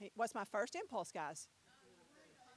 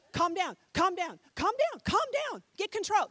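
a hint of an urgent inner voice, higher and louder; Holy Spirit calming; 5 semitones higher, 4 dB louder than usual. Preacher voicing the Spirit calming his temper, urgent. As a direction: soothe yourself hard in the heat of the moment. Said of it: "That's what I hear, you know, the Holy Spirit".

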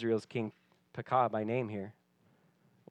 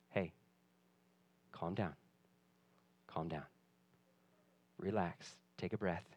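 soothing parent; 8 semitones lower, 15 dB quieter than usual. Pretend. Parent soothing panicking kids.

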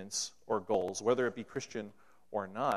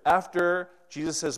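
usual speaking voice

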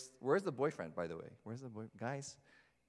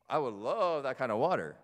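loud, mock-eager gushing; sarcastic; 2 semitones higher, 6 dB louder than usual. Samaritan woman on the living water, sarcastic. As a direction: lean hard on the fake enthusiasm. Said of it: "you kinda hear that tone".

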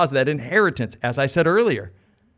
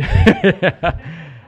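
loud, higher-pitched laugh; 4 semitones higher, 5 dB louder than usual. Real, not acted. Preacher joking with the congregation about heaven's accent, laughing out loud. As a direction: burst out laughing at your own joke. Laughs at own Welsh joke.

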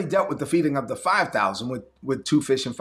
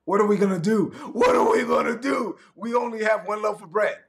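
high shouted frantic worry; mock panic; 4 semitones higher than usual. Pastor mocking disciples, high shouted panic. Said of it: "And they're like".

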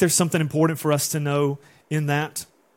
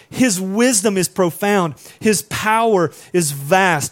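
reading Job aloud, rising in force; scripture reading; 2 semitones higher, 4 dB louder than usual. Acted. Pastor reading Job to the church, building. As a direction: build the reading as Job's words swell.

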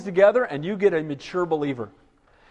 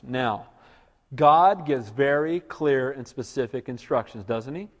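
ordinary talk, nothing marked

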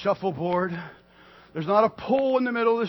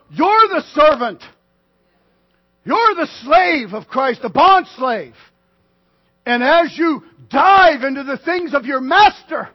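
ordinary delivery

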